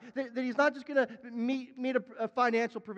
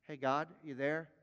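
lower, softer, offhand check-in; casual calling; 5 semitones lower, 5 dB quieter than usual. Acted. Preacher mimicking a half-hearted prayer, casual and low. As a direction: toss it out like a casual hello. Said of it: "This isn't like".

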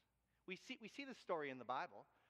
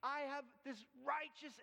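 self-righteous Moses; 7 semitones higher than usual. Acted. Moses voiced asserting himself.

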